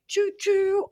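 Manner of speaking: high sing-song choo-choo; train whistle. Retold or imitated. Playful train noise for the drama train. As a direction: toot it like a little train.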